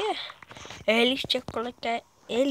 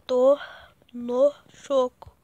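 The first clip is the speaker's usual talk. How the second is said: silly voice; 7 dB louder, much slower than usual. Pretend. Odd put-on voice line.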